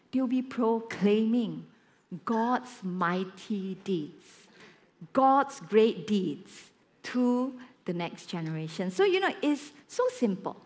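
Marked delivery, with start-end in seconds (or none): 0.1-8.9 slow, lower, deliberate proclamation; solemn preaching. Real, not acted. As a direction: weigh each word for the congregation. Preaches the closing point.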